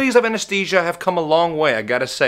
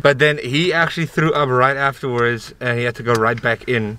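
muffled behind surgical mask; speaking with jaw wrapped; through a face mask; 5 semitones lower than usual. Real, not acted. Seen 5: Blue surgical mask covers mouth and nose throughout; jaw wrap too.

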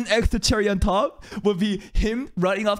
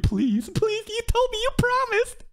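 high, whiny mock pleading; begging. Fan imagining a rival begging Sakayanagi, whiny plea. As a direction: beg pathetically on your knees. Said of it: "just begging Sakayanagi".

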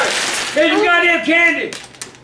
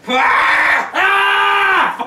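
harsh long bellows of rage; raging scream; 3 semitones higher than usual. Real, not acted. Dad over his broken chair, raging scream. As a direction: scream out the fury, wordless.

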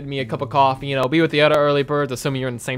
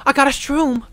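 excited calling; 9 semitones higher, 5 dB louder than usual. Pretend. Kid calling out to grandma.

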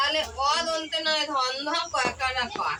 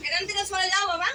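calling out; 4 semitones higher than usual. Real, not acted. Teacher shoos away interrupting child.

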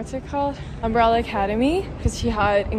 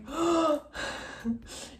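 startled gasp; 7 semitones higher, 6 dB quieter than usual. Retold or imitated. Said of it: "I was like".

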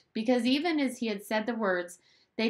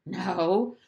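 a touch of an awkward laugh in the word; mildly nervous, half-laughed denial; 4 dB louder than usual. A narrator's reading. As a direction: laugh it off a little uneasily. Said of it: "laughing nervously".

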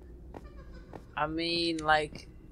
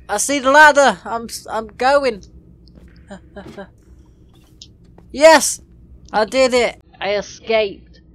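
triumphant shout; 4 semitones higher, 8 dB louder than usual. Real, not acted. Escapes the map.

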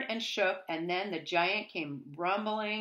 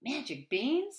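surprised question; 7 semitones higher than usual. Acted. Jack surprised by magic beans.

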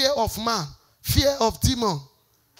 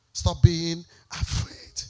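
slower softer plea; 5 semitones lower, 7 dB quieter, much slower than usual. Real, not acted. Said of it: "repeated softer plea".

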